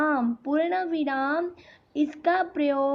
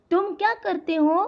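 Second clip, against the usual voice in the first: higher-pitched question, stressing "क्या"; raised, inquiring tune lifting to "हो"; 4 semitones higher than usual. Shown as a demonstration. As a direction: ask it with "क्या" prominent and a rise on "हो". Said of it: "तो ये भी प्रश्नार्थ चिन्ह".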